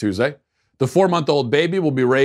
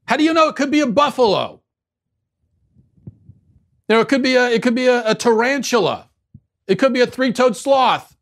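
shrill high indignant rant, much higher and louder; mock outrage; 10 semitones higher, 3 dB louder than usual. Host parodying a gender activist in a shrill, outraged voice. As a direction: ham up a hysterical activist.

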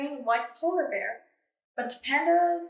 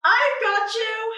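calling out; 9 semitones higher, 5 dB louder, slower than usual. Acted. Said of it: "the panda bear called".

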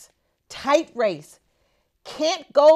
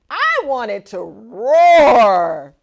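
louder, slower, big-voiced demand; eager roar; 11 dB louder, slower than usual. Hamster demanding a roaring engine, loud and slow. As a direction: growl out the wish for power.

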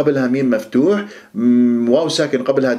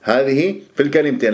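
ordinary talk, nothing marked

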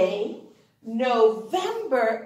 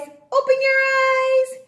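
high, loud reveal; spring the surprise; 12 semitones higher, 6 dB louder than usual. Acted.

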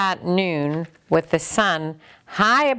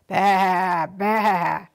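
goat bleat. Acted. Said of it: "All she heard was".

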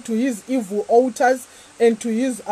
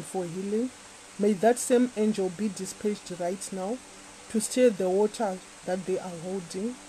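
prayer; 4 semitones lower, 6 dB quieter than usual. Acted. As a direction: pray gently over them. Quiet prayer over the water.

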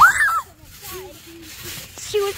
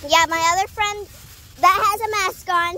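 ordinary talk, nothing marked

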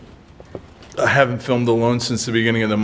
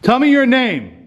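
higher, challenging call into the dark; provoking the spirit; 7 semitones higher, slower than usual. Real, not acted. Ghost hunter challenging the spirit to give its name, raised taunting call. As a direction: taunt the presence to answer.

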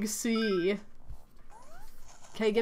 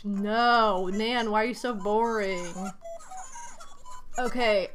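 usual speaking voice

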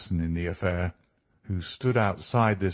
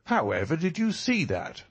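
high, amazed question from an inspector; astonished cry; 8 semitones higher than usual. A narrator's reading. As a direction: ask in amazement, pitch swooping high. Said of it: "cried the inspector".

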